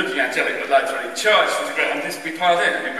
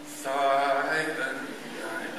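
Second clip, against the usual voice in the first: very slow, much softer hymn title; slow hymn drawl; 7 dB quieter, much slower than usual. Retold or imitated. Choirboys walking down the aisle, slow solemn. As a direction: stretch it out like a slow procession. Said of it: "like this".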